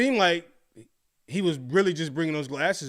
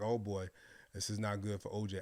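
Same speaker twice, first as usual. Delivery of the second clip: inner thought; 6 semitones lower, 12 dB quieter than usual. Retold or imitated. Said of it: "I remember thinking like".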